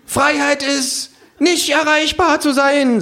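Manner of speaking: high, drawn-out proclamation; kangaroo proclaiming. A narrator's reading. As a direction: cry it out like a speech from the bar. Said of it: "sagt das Känguru".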